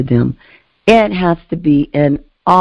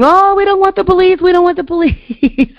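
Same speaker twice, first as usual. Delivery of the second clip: mock kids screaming; 14 semitones higher than usual. Kids panicking as police arrive, high mock wail. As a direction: squeal it like frightened kids. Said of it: "the kids were screaming".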